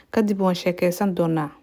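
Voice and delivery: normal talking voice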